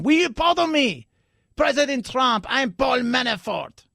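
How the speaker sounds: exaggerated accent, pleading up high; Paul Manafort. Host as a man begging a pardon, put-on accent. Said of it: "I like to give him an accent".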